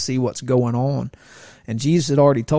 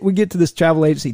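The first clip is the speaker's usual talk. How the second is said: ordinary talk, nothing marked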